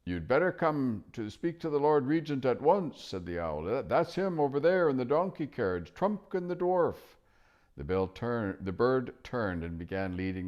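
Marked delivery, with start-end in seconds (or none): 0.0-3.0 high hooting owl voice. Acted. Owl telling children to meet the Lord Regent. 3.6-7.0 high hooting owl voice. Acted. Owl telling children to meet the Lord Regent.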